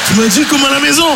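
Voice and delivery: high-pitched, whiny indignation; squeaky aggrieved passenger; indignant protest. Acted. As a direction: protest as if wrongly told off. Naked passenger protests to the hostess.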